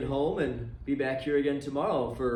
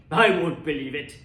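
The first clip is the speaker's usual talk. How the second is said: louder, stubborn Scrooge voice; defiant old miser; 5 dB louder than usual. Acted. Scrooge refusing to believe the ghost coming through the door, loud defiance. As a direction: bark the denial at the ghost.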